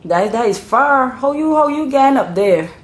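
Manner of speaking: mock amazement at the distance; incredulous islander. A Bajan amazed at someone's long trip home, incredulous. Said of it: "they say".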